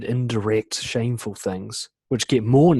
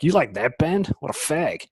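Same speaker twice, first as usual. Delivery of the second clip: higher-pitched jeer of disbelief; mocking peer; 5 semitones higher than usual. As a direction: scoff at his taste, pitched up. Voices a peer jeering.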